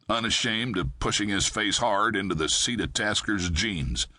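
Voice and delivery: ordinary delivery, nothing marked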